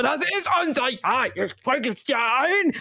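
hyped-up mock yelling; shaking him insistently. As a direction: insist as if shaking a friend by the shoulders. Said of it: "Und ich schüttel ihn so".

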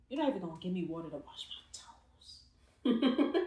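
unvoiced whisper, teasing; playful whispered gripe about toes; mock whispered complaint. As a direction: hiss the grievance under breath. Voicing Jesus, whispered.

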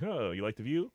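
softly needling, mock-innocent question; a quiet, understated passive-aggressive dig. Retelling shutting the plane window on her, softly passive-aggressive. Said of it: "definitely the most passive aggressive".

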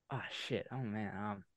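muttering. Real, not acted. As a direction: mutter it under your breath. Muttering over a hard draft choice.

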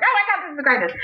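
high delighted gush; thrilled, loving every second; imitating her thrilled self; excited. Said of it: "I was like".

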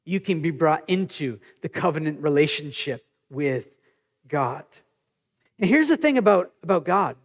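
ordinary talk

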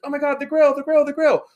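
very high, frantic passenger voice; mock panicked shriek. Passenger turning round to see the grill fly off, panicked. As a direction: squeal it in alarm, imitating a friend.

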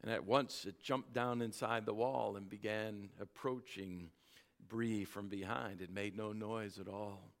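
loud, lively storybook narration; story reading. A narrator's reading. Preacher reading a Narnia story to the church, animated. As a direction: read the tale with gusto to the congregation.

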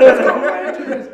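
loud, high, drawn-out fan cheer; jubilant yell. Retold or imitated. Fans celebrate the Rangers' win, jubilant long yell. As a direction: cheer the team on, laughing.

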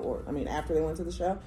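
ordinary talk, nothing marked

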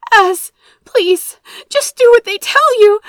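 high, loud, tearful begging; desperate, on the edge of tears; frightened pleading. A narrator's reading. Captive sister begs brother to comply, frightened.

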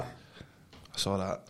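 low breathy near-whisper, barely voiced; hushed confrontation. Boss quietly confronting the thief, half whispered. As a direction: say it under your breath, laughing.

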